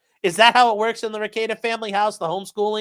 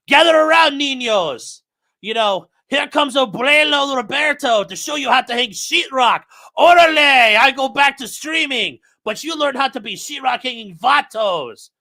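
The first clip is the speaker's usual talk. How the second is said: hammed-up Mexican accent, higher and louder; mock Mexican grandpa; 5 semitones higher, 4 dB louder than usual. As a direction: play a Mexican abuelo rallying the kids. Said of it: "He's like".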